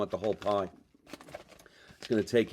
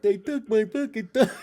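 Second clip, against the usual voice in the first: raised, loud mock wail of the thumb line; quoting the line higher and louder; movie line quote; 12 semitones higher, 5 dB louder than usual. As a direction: belt out the quote like a hammed-up movie scene. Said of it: "Eric Roberts".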